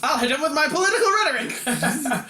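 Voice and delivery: high, laughing villain threat; mock villain boast. Jokes as villain with ray.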